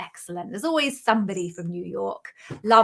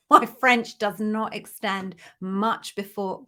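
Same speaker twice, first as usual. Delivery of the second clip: normal talking voice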